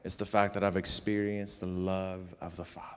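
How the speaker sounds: soft, low reassurance; gentle shepherding. Retold or imitated. Father shepherding his frustrated son at dinner, gently reassuring. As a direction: quietly reassure an upset child, voice dropped right down. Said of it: "I was able to shepherd at that point".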